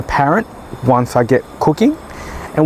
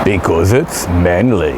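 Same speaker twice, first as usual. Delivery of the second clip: much lower, slow macho growl; mock manly voice; 8 semitones lower, slower than usual. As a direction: drop deep into a hammed-up he-man voice. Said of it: "Why am I talking like that?".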